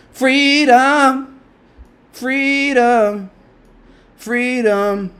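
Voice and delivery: high, loud battle cry that fades each time; mock war cry, building down to plain; Braveheart freedom cry. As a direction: yell freedom like a movie hero, then die down.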